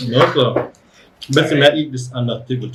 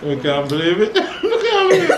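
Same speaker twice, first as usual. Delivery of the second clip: laughing in disbelief; high giggling disbelief; 9 semitones higher than usual. Real, not acted. Laughing at friend's pain.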